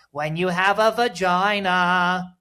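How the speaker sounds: high, slow funny voice. Retold or imitated. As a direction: imitate the lady's goofy voice. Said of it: "in the funniest voice ever".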